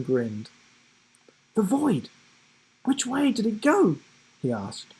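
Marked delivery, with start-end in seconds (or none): 1.5-4.0 urgent question. A narrator's reading. Giraffe asks urgently.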